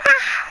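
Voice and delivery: high-pitched imitated scream; short, very high scream, acted out; retold scream. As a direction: yelp in mock terror. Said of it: "I'm screaming".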